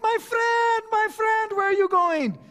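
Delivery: loud, very high imploring shout; calling out. Retold or imitated. Merchant calling back a departing customer, loud and pleading. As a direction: holler after someone walking away, hammed-up.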